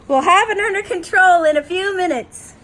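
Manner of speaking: high, loud official reassurance; hammed-up announcer-like promise; placating cruise director. Rereads cruise director placating passengers.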